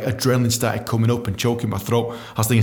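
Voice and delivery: ordinary talk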